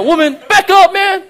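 high, loud shove-off; exasperated back off; a preacher hamming up Jesus' brush-off; comic rebuff. Retold or imitated. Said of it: "my translation".

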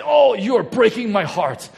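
high, loud, hammed-up heartbroken parent; mock melodramatic movie mom wailing; wounded parent. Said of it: "and the parents say".

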